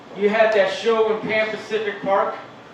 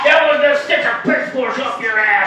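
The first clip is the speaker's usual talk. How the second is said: Exorcist demon voice. Retold or imitated. Homeless man on the corner sounding possessed, demonic shout. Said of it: "they sound like they're from The Exorcist".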